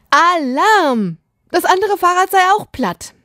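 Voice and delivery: high, sustained alarm call; alarm-siren pitch, held high; alarmed cry. A narrator's reading. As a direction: sound the alarm like a panicked child. Child reports flat bike in alarm.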